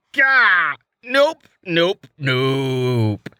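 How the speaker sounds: gagging, half-laughing refusal; spluttering disgust. A narrator's reading. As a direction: spit the words out at a sour taste. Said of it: "he spluttered".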